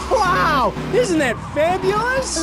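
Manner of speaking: high, delighted, drawn out; excited gushing. Acted. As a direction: gush over it like a proud parent. Showing newborn giraffe.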